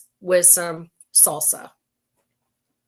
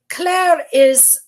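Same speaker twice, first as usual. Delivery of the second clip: lilting mock Irish, higher and louder; accidental Irish lilt; 8 semitones higher, 6 dB louder than usual. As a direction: slip into a playful Irish accent. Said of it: "how come I just went Ireland?".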